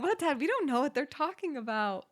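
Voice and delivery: high-pitched imitation of clueless kids; acted baffled denial. Daughters denying the screaming to Dad, mock bafflement.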